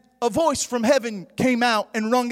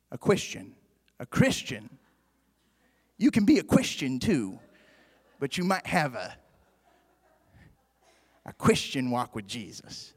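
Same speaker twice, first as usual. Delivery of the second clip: much softer and lower, close and intimate; soft aside; 9 semitones lower, 6 dB quieter than usual. Acted. Preacher to congregation, a sudden soft hushed aside. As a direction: drop right down, almost to a hush.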